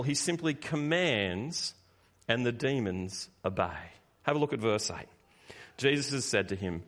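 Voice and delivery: ordinary talk, nothing marked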